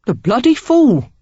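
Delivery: loud, high Cockney outburst; Cockney exclamation. A narrator's reading. Stranger scorning Craven for Sarah, loud Cockney. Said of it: "the stranger exclaimed".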